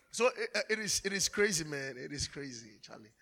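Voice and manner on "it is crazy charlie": ordinary talk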